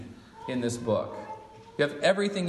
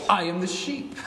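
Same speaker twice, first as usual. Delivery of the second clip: higher-pitched mock French accent; Jesus in French accent; 4 semitones higher than usual. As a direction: put on a playful French accent. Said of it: "try to do my best French accent".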